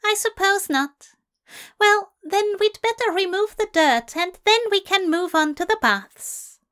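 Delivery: high, flustered fussy angel; flustered Aziraphale. Acted. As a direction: babble on, flustered and prim. Aziraphale voiced by narrator.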